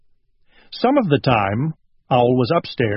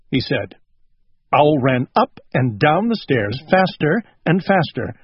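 usual speaking voice

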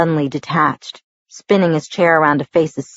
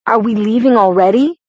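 rising, a little louder; surprised; 5 semitones higher, 4 dB louder than usual. A narrator's reading. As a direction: caught off guard, asking. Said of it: "Jacob was surprised".

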